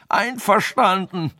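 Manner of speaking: high, loud, drawn-out shout; terrified sage screaming his consent to the king; shouted agreement. Acted. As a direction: yell it out slowly in panic. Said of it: "schrie der Weise".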